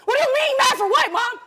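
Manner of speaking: high, loud, disbelieving protest; indignant outburst. Real, not acted. Daughter snapping back at her mom about being mad, indignant and loud. Daughter confronts mother on stage.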